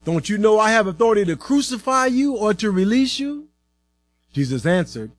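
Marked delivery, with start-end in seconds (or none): 0.0-3.5 insistent, higher-pitched challenge; indignant Pilate. A narrator's reading. Pilate warns Jesus of his authority, indignant. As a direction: press your power on him, voice raised in pitch.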